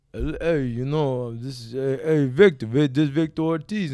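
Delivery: halting Rocky-style impression on the phone; punchy, stumbling movie-boxer voice; Sylvester Stallone. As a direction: imitate the action star introducing himself. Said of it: "Make sure you do his voice".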